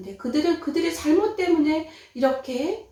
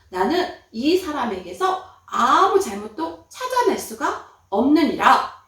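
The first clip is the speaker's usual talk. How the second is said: slow, louder governor's proclamation; slow, solemn ruling from the judgment seat; Pilate declaring; 7 dB louder, slower than usual. Acted. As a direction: pronounce it slowly like a judge addressing the crowd. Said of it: "그렇게 얘기하는 것이었어요".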